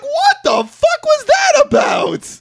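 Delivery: very high, slow, loud shout; hammed-up incredulous yell; outraged disbelief. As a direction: scream it in drawn-out bewilderment. Outraged reaction to retold scene.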